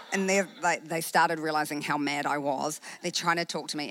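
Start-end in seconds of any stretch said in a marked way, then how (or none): none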